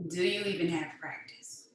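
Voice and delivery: subdued repeat, almost to herself; quiet repeat. Acted. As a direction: let it drop quietly.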